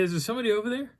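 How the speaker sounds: high, questioning call. Retold or imitated. Hiker to whoever is behind the trees, high call. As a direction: call over to someone unseen. Calling toward unseen presence.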